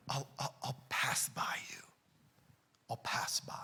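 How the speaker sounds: hushed, breathy whisper of God. Retold or imitated. Preacher whispering God's answer to Elijah. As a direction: whisper it like a passing presence.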